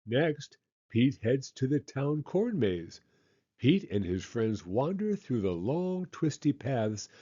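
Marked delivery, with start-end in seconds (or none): none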